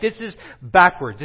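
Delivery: usual speaking voice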